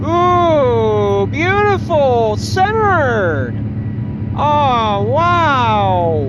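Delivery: loud, high, thrilled praise; excited planning. Real, not acted. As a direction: gush over a perfect landing. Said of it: "How was that takeoff according to".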